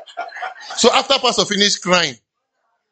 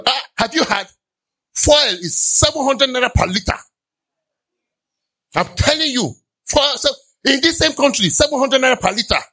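high, excited gossip voice; a mock-alarmed gossip, pitched up; gossiping complainer; 7 semitones higher than usual. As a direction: spread the bad news breathlessly.

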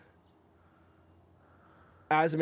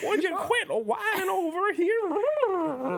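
very high, slow squeaky cartoon voice; reactive Ronnie voice; slower than usual. Ronnie snaps back at Willie, very high and slow. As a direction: bicker in a silly high voice.

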